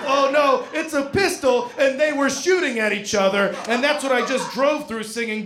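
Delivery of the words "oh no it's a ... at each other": alarmed realization. Real, not acted. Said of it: "And so I read".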